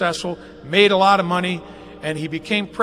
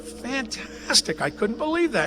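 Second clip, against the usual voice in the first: higher, slow, softer mock wonder; retold amazement; 7 semitones higher, 5 dB quieter than usual. Recalling reading about pansies, slow higher mock amazement. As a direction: savor it slowly, pitched up in disbelief. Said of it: "And I thought".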